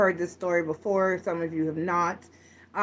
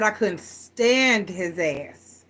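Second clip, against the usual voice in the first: "stand" drawn out long and slow in retold exasperation; exasperated emphasis; much slower than usual. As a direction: lean hard and slowly on "stand", imitating a fed-up wife. Old lady vents about husband, retold.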